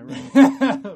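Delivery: laughing. Real, not acted. Joke, laughing.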